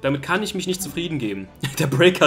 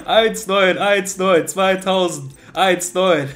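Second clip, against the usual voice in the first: excited counting; 4 semitones higher, 4 dB louder, much slower than usual. Real, not acted. Counting opponent's walls.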